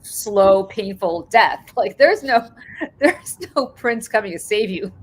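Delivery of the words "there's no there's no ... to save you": ordinary talk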